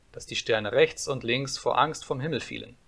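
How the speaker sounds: plain reading voice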